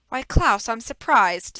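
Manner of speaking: villain hamming up surprise; mock surprise. Stefano feigns surprise.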